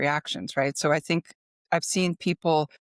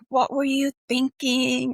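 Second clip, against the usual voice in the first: higher, slow, mock-angry bark of a brash New Yorker; brash boss yell; 8 semitones higher, 3 dB louder, slower than usual. Brash New York boss scolding a worker who messed up, mock yell. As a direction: hammer the employee with it like an irritated boss. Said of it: "just yell at you".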